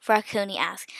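ordinary delivery, nothing marked

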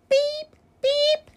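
two very high little peeps; tiny high peeping; chick peep. A narrator's reading. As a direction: cheep like a baby chick. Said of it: "her chick said".

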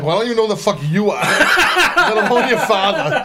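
laughing through a snappy comeback; mock comeback breaking into laughter; laughing retort. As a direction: crack up while firing back. Retorting to a name-dropper.

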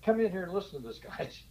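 lightly acted call for everyone to come listen; eager colleague calling people in; editor on intercom. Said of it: "got on the intercom, and said".

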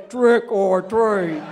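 low, slow trick or treat. Retold or imitated. To the woman at the door, a slow low second-child voice. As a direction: drop down low like the bottom child. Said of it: "and I'd come down here, and I'd say".

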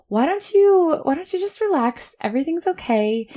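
husband calming her. Retold or imitated.

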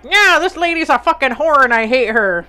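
hammed-up impression of a feisty grandma; imitating Scottish grandmother. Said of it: "So she'll be like".

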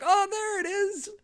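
high, drawn-out cry of discovery; finally found it. Retold or imitated. Host retelling his Twitter search, high drawn-out delight. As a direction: let relief lift the pitch way up, slowly.

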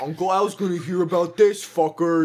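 loud, high comic tattling; exaggerated outraged counselor voice; mock angry threat. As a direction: bellow a hammed-up threat to report them. Joins in imitating angry counselor.

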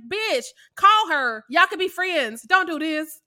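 loud, slow exasperated scolding. Retold or imitated. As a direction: scold them loudly. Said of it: "And I'm like".

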